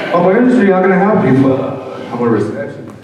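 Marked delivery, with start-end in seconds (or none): 0.1-1.5 high, loud bright phone greeting; receptionist. Retold or imitated. Receptionist picking up the office phone, high loud greeting.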